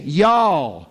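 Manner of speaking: "Y'all" said as a drawn-out Texan yall; joking Southern "Y'all" with a falling close. Pretend. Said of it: "he was Texan".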